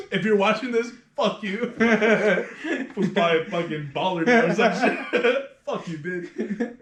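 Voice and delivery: cheerful cussing through laughter; laughing taunt. Real, not acted. Barber addressing the client if watching, laughing taunt. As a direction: crack up while flipping off the listener.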